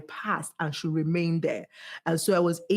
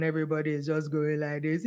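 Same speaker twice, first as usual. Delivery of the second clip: monotone robotic demo; 3 semitones lower than usual. Shown as a demonstration. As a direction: drone it on one note like a robot. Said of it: "It sounds like robots".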